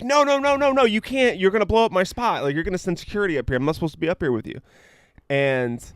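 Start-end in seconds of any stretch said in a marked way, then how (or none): 0.0-4.6 high-pitched frantic pleading, rushing faster at the end; panicked hushing. Retold or imitated. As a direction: beg her to stop before security comes. Hushing a singer in hotel.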